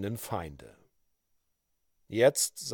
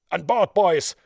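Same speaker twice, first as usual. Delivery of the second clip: loud commanding roar; pirate chief thundering at his crew; thundering shout; 5 semitones higher, 7 dB louder than usual. A narrator's reading. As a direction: bellow the order to board. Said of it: "schrie die donnernde Stimme des Piratenhäuptlings".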